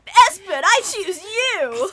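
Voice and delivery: high, loud, drawn-out battle call; hammed-up heroic anime shout; Pokemon trainer. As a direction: summon a monster into battle. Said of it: "is it like a Pokemon?".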